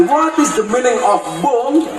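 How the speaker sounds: slow, puzzled foreigner's English; foreign guest asking. Retold or imitated. Australian guest asking what bung means, slow and puzzled. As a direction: ask slowly like a puzzled visitor. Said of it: "Akhirnya dia tanya lagi".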